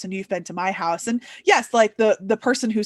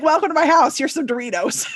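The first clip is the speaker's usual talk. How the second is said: cheerful host; 6 semitones higher, 4 dB louder than usual. Retold or imitated. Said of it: "I'd be like".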